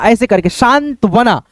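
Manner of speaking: normal talking voice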